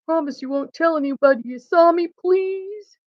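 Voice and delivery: lightly voiced, high and quick plea; pleading Santa. A narrator's reading. Santa asks the bears to keep his secret, lightly pleading. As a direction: ask it quickly, rising into a drawn please.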